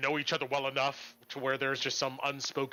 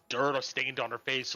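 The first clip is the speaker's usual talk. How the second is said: ordinary talk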